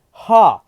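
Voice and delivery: sharp, very loud, high mocking "Ha!"; scornful loud laugh bark. Deku to his parents, a loud scornful laugh. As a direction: spit a contemptuous laugh. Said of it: "he says".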